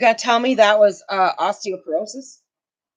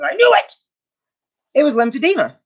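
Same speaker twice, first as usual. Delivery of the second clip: triumphant; 7 semitones higher, faster than usual. Retold or imitated. Vindicated about diagnosis.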